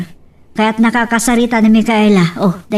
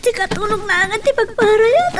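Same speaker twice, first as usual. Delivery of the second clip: strained, breathy groaning of a woman in labor, higher and softer; labor pain; 8 semitones higher, 4 dB quieter than usual. Acted. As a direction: push each word out through the pain. (agsasainnek gapu iti ut-ot ti panaganak)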